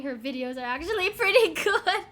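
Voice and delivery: high, loud, laughing delight. Real, not acted. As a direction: burst out laughing through the words. Laughing at being talked about in game.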